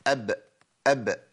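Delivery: أب with the ب released in a small bounce; qalqala demo. Shown as a demonstration. The sheikh repeats أب to show the qalqala. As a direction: repeat أب, letting the ب echo lightly. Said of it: "ما هي دي القلقلة".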